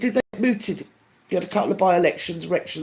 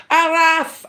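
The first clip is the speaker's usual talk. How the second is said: mock sign shout; 10 semitones higher, 4 dB louder than usual. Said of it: "you get".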